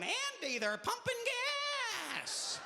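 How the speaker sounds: high, panicky deputy voice; Barney Fife. Retold or imitated. Excited cop reporting the gas pumping to Andy, squealing Barney Fife impression. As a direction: squeal it like a frantic small-town deputy. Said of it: "like Barney Fife going".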